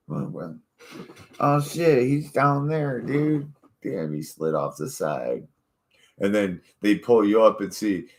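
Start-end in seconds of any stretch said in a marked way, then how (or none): 1.4-3.5 mock rescuer. Acting out rescuers spotting him.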